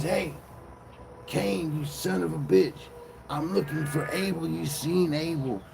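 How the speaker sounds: high-pitched calling voice, demanding an answer; God. Acted. God coming down looking for Abel, calling out. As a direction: call someone out from above, pitched well up. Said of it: "God comes down and says".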